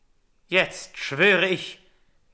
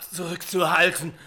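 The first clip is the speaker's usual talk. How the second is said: usual speaking voice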